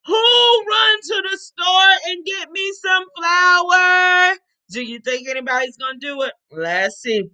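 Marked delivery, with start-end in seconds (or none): 0.1-4.4 calling out. Acted. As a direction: sing it out to the whole street. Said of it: "She stuck her head out the window".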